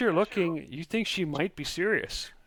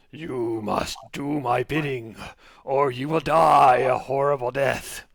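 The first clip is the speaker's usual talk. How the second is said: demon voice; 6 dB louder than usual. Acted. GM voices demon in crystal ball.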